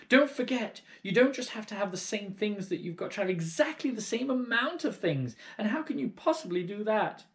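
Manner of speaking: high, doubting wife voice; fussy wife. Acted. Wife scolding husband over the brew, high and fussy. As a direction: nag him in a high pitch.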